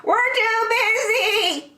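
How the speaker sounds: high, slow bleating refusal; high silly sheep voice; busy sheep. Acted. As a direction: fob him off like a fussy sheep.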